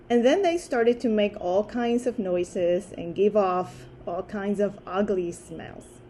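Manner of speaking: ordinary delivery, nothing marked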